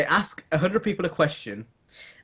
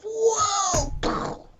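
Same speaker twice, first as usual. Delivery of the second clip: long high-pitched "whoa"; imitated whoosh. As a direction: draw out a high mock cry as it pops out. Imitates something popping out at birth.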